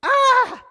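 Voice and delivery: loud, high yell of dismay; wit's-end scream. Acted. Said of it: "you're just at your wit's end".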